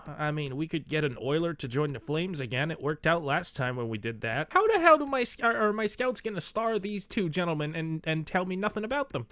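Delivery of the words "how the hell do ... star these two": high, loud frustrated outburst that cuts off; exasperated outburst. Real, not acted. Gamer venting at his scouts, loud and high-pitched. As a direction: fire it at the useless scouts.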